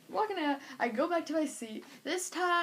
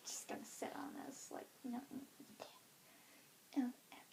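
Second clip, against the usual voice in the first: soft awkward whisper; 2 semitones lower, 17 dB quieter than usual. Retold or imitated. Girl beside losing friends, awkward whisper. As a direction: mumble it under your breath.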